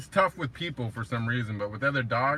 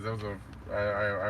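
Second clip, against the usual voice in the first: normal talking voice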